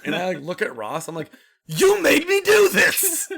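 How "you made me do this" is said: high, loud, slow laughing accusation; mock accusation. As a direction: wail it out through laughter. Mock accusing his friend.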